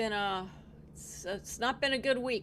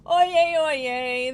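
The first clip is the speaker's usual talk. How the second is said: loud ringing oyez call; town crier; 4 semitones higher, 9 dB louder than usual. Acted. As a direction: cry it out like a herald. Bailiff opens comedy court.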